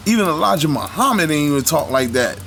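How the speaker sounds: normal talking voice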